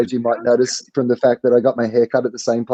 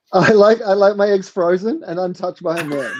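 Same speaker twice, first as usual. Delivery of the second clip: higher-pitched comic imitation; Hannah Gadsby impression; 7 semitones higher than usual. Comedian answering the egg question as another comic, in impression. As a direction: put on a fellow comedian's higher voice. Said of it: "doing a great impression of Hannah".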